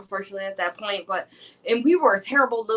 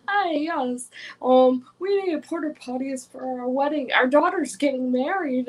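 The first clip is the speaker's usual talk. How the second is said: adult-like voice; 2 semitones higher than usual. Retold or imitated. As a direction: kid faking a grown-up phone voice. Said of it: "tried to sound adult-like".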